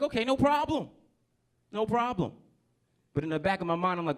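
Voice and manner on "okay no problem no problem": high-pitched, quick-to-please assent; eager agreement. Acted. Man agreeing to lady friend's rules, high eager voice.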